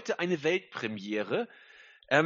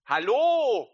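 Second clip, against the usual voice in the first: excited greeting; 4 semitones higher, 4 dB louder than usual. Real, not acted. Greeting guest live.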